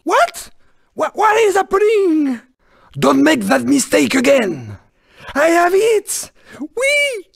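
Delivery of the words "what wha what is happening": high-pitched startled question, stammering; theatrical bewilderment; startled alarm. Acted. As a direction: jump up in pitch, caught off guard. Racer caught off guard.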